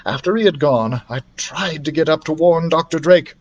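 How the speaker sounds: usual speaking voice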